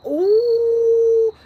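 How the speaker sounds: long, high held "ooh"; re-enacted high ooh of shock. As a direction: let out a high, drawn-out ooh. Acts out own shocked reaction.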